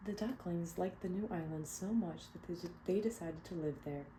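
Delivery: clear, expressive read-aloud narration; storybook reading. Acted. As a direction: read it to a room of kids. Reading picture book aloud.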